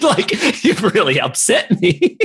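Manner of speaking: real laughter through mock hurt; giggling through a pretend complaint; laughing. Real, not acted. Laughing while saying he'll cry.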